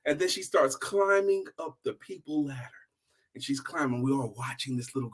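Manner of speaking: breathy storyteller's hush; hushed whisper. A narrator's reading. Preacher hushing the congregation as the girl climbs. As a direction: whisper it, building suspense.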